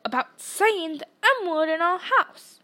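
a loud telling-off; thundering. A narrator's reading. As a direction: keep thundering to the end. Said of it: "thundered his uncle".

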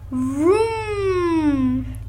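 ordinary delivery, nothing marked

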